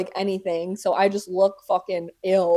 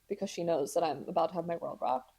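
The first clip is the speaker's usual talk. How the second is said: ordinary talk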